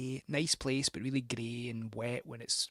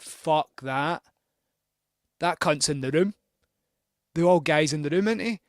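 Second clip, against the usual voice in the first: alarmed loud outburst; 4 semitones higher, 10 dB louder than usual. Retold or imitated. Hears a sigh in the room.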